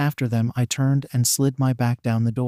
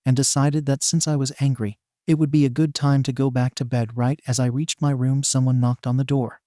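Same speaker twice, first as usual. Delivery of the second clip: usual speaking voice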